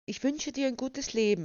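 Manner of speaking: plain reading voice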